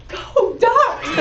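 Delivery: high excited yell of a fan; excited shout. Retold or imitated. Woman at a car window cheering at a Ducks shirt, high shout. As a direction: belt out the team cheer, laughing. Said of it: "she goes".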